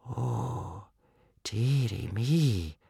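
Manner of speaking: soft, very slow gasp; gasped dismay. A narrator's reading. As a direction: breathe it out slowly in quiet dismay. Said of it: "gasped old Wrinkly".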